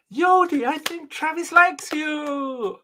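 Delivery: hammed-up girlish friend. Co-host mocking the friend's line, sing-song and high. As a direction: ham up a teasing girlfriend.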